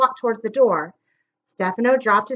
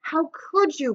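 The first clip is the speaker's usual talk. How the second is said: soft, strained question; hoarse whisper; 5 semitones higher, 5 dB quieter, slower than usual. A narrator's reading. Klaus confronting Stefano over his uncle's body, hoarse whisper. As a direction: barely get it out, choked. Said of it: "Klaus whispered hoarsely".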